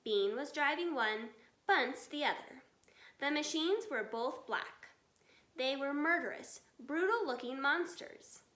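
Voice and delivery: plain reading voice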